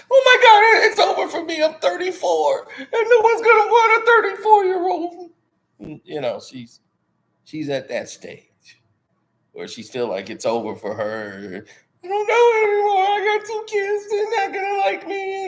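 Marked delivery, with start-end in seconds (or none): 0.1-5.3 high, loud whiny wail of a desperate woman; high, half-laughing mock lament. 12.0-15.5 high, loud whiny wail of a desperate woman; high, half-laughing mock lament. Mocking her complaint.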